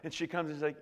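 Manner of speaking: ordinary talk, nothing marked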